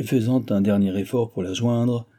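usual speaking voice

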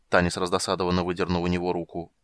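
ordinary delivery, nothing marked

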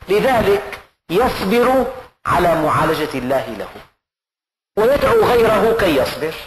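loud, ringing sermon delivery; forceful preaching. Acted. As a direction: preach it out to the hall. Preacher at full force opening. (وعظ خطابي بصوت عالٍ)

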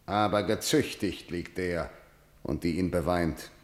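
low, soft pronouncement; grim finality. Acted. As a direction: drop the voice and close the matter. Kreon reports the punishment, grimly.

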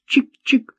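high, short scissor snips; imitated snipping. A narrator's reading. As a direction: mimic shears snipping in a high voice. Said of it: "сопровождая это звуками". (изображая щёлканье секатора)

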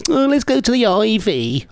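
high, mock-posh girlfriend voice; posh Kylie. Host mocking Kylie's fancy restaurant wish, mock-posh and high. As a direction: lift it up and put on airs. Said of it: "She'll be wanting to go".

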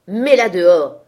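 very loud, ferocious order; explosive, drawn-out yell; savage shout. A narrator's reading. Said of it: "cria Heathcliff avec sauvagerie".

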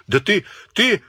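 high, spluttering stammer; breathless sputter; choking with rage. A narrator's reading. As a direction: choke on the words in fury. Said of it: "Михаил Елизарыч задохся".

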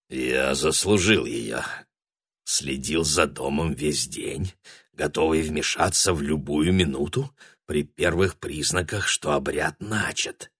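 низкий медленный голос; спокойный голос великана; giant's low voice. A narrator's reading. Разговор с лысым великаном. (низкий голос великана)

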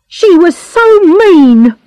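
high, loud, drawn-out outburst; angry cry. A narrator's reading. Bernard breaks his silence about Missus Blakemore, angry cry. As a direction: burst out with long-held resentment. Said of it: "he cried angrily".

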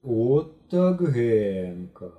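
slow, stretched amazement; drawled wonder; drawn out. A narrator's reading. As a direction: stretch it out in awe. Boys admire the table.

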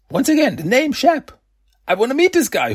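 high, mock-amazed repeat of the name; amused disbelief. Real, not acted. Vlogger relishing the name Shep, amused and high.

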